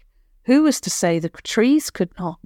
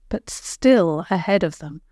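plain reading voice

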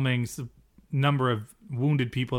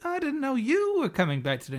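high-pitched imitation of a woman; lightly mocked high female voice; Anna; 11 semitones higher than usual. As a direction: pitch it way up, acting her part. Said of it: "And Anna's like".

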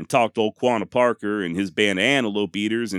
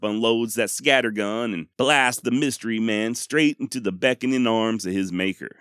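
usual speaking voice

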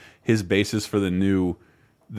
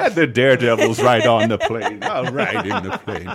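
Magoo quote, laughing; 3 dB louder than usual. Retold or imitated. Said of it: "he lived a George Carlin joke".

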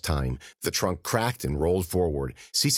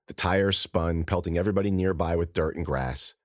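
plain reading voice

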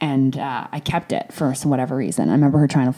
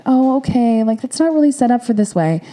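much higher, louder, put-on voice of a dismissive boss; mock employer; 9 semitones higher, 4 dB louder than usual. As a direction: imitate the office brushing her off. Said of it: "they're like".

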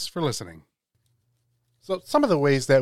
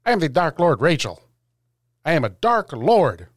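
loud; 4 semitones higher, 8 dB louder than usual. Acted. Rachel's comic dark lord intro.

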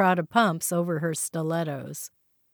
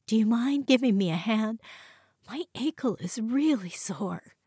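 pleading; 4 semitones higher than usual. Acted. Wife asks husband for help.

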